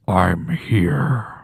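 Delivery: low, loud menacing growl; creepy low Freddy reply. Retold or imitated. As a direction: lurk low like the killer. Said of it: "all you hear is Freddy go".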